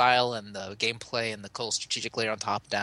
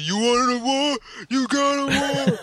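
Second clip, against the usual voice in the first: high, loud action hero shout; Stallone; 13 semitones higher, 7 dB louder, slower than usual. Retold or imitated. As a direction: bellow it like a movie commando. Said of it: "fake Stallone going".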